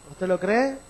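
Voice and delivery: loud rousing preacher call; preaching at full force. Acted. Preacher calling the congregation to applaud, loud and rousing. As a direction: stir the church to applaud, loud.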